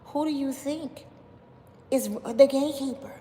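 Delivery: high-pitched, deliberate dramatic questioning; high, theatrical put-on voice; Jag impression. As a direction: act out an overdramatic accuser posing questions. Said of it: "she speaks like this".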